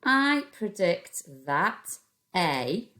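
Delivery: I predict that a drawn out slowly; teacher dictates while writing; slow dictation. Shown as a demonstration. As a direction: say I predict that a slowly as you write. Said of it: "leave a finger space".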